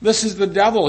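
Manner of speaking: normal talking voice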